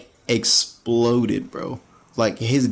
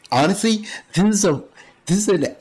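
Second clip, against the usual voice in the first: laughing through words; 9 semitones higher than usual. Real, not acted. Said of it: "I'm laughing".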